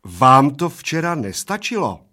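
waitress warning. Acted. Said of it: "varovala oba slovy".

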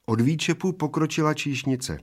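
usual speaking voice

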